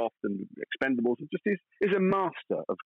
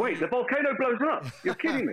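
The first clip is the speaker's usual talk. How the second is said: high, hammed-up disbelief at the volcano; mock astonishment; 10 semitones higher than usual. Actor teasing the interviewer about the plot, mock astonished. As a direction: act shocked that the volcano blows up.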